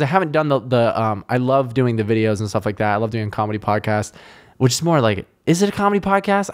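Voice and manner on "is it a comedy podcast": high, quick put-on voice; mock questioning. Host questioning his own podcast, high mock voice. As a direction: ask it high and fast, mock-puzzled.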